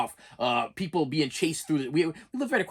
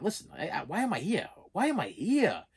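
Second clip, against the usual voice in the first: higher pitched, exasperated retold outburst; getting worked up; 6 semitones higher than usual. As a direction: replay the frustration at being stuck at work. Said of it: "I'm getting worked up".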